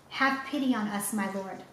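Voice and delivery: ordinary delivery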